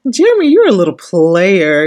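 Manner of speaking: loud, playful jab at the guy; loud ribbing of the friend; teasing callout. Real, not acted. Calling out cast member.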